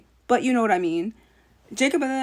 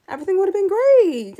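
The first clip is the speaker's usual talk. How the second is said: louder and higher, gushing; excited; 9 semitones higher, 6 dB louder than usual. Real, not acted. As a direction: sell the dream ending with a burst of excitement. Gushing over imagined better ending.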